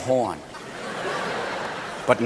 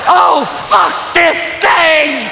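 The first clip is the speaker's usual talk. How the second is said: high, drawn-out hollering; strained high comic rant; exasperated yelling; 6 semitones higher, slower than usual. Acted. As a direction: wail it in hammed-up despair. Acted outburst of exasperated climax.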